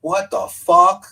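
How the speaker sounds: loud, high, drawn-out incredulous shout; imitated yell. Driver yells at him from the car window, imitated loud yell. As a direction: yell it like a startled passing driver. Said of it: "yell at me".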